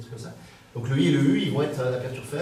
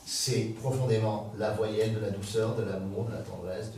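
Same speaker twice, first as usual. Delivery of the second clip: tender; 3 semitones lower than usual. Shown as a demonstration. Speaker praising the vowel of gentleness, tender. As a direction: savour it gently, fading as you go. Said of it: "il vient exprimer cette tendresse, cette douceur".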